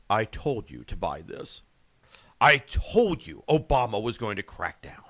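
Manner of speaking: low hushed apocalyptic radio voice; Glenn Beck impression. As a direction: intone it gravely like a prophet. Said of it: "channel my inner Glenn Beck".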